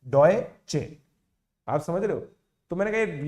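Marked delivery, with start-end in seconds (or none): 0.1-1.0 डॉय चे split into doy and che, louder; syllable breakdown. Shown as a demonstration. Explaining to viewers where the che sound sits, डॉय चे in two beats. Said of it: "इस पूरे का नाम डॉय है".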